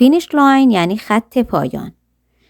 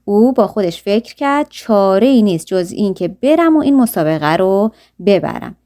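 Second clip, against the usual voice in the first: ordinary delivery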